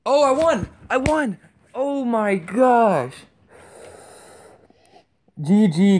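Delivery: high, loud joyful shout; victory cheer. Real, not acted. Gamer wins the match, high joyful shouting. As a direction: cheer the win, stretching the last words.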